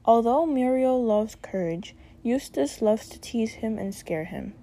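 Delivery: ordinary talk, nothing marked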